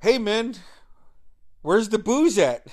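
loud, high holler; calling out. Retold or imitated. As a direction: shout over to a friend riding past. Ira calling to passing cyclist.

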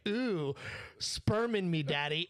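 high-pitched hammed-up "ooh"; mock dirty talk. Coworker teasing a married couple, mock dirty talk. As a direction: imitate a coworker's joke come-on. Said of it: "How the fuck do y'all talk dirty".

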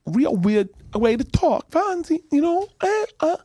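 very high, odd talking voice; Pinky Tuscadero. Acted. Fonzie's girlfriend in Happy Days talking to him, weird high voice. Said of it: "And she had this".

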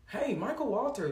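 high-pitched Southern pastor on the phone; playful Southern caller voice; Southern man impression.

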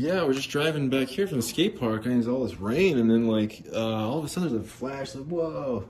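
high, excited surfer-dude voice; surfer guy. Retold or imitated. To the TV reporter, a surfer dude recounts the flash, high and stoked. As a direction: ham up a stoked surfer guy telling the storm story. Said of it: "It was like that, uh, surfer guy".

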